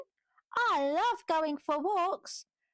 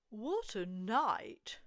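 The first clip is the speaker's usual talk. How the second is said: much lower, softer, slow mutter; muttering mole; 11 semitones lower, 7 dB quieter, slower than usual. Acted. Mole complaining about the storm, low muttering. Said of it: "Mole mutters".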